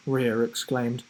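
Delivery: plain reading voice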